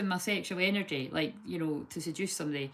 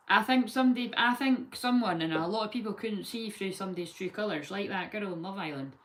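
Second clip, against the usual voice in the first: usual speaking voice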